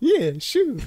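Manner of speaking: very high mock Brooklyn yes; Brooklyn accent. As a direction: echo the yes in a squeaky Brooklyn voice. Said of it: "they both have Brooklyn accents".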